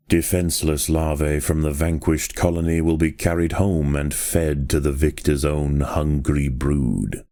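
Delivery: normal talking voice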